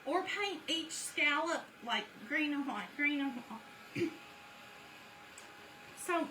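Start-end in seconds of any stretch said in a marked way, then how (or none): 4.1-6.0 throat clearing. Real, not acted. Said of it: "sorry, I'm getting choked".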